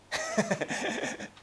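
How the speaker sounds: short chuckle at one's own joke; amused laugh. Real, not acted. Pastor joking about overhearing prayers, amused laugh. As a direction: laugh it off briefly.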